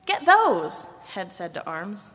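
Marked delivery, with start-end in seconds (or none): 0.1-0.9 high-pitched eager order; commanding. A narrator's reading. Head tells Arms to grab the legs, commanding. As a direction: order a helper to grab something.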